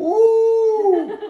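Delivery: very high, loud drawn-out "ooh"; loud whoop. Real, not acted. Coach hollering after a hard set, loud whoop. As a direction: let out a long piercing whoop.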